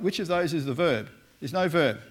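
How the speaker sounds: ordinary delivery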